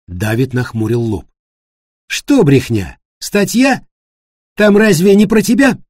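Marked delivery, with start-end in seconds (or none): 2.1-5.8 high, incredulous pressing questions, getting louder; challenging questions. Acted. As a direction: throw the denial back as pointed questions. David challenges Florian's denial. (напористое, недоверчивое переспрашивание на высоких тонах)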